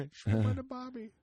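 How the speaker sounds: mock Australian accent. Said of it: "the worst Australian accent you'll ever hear".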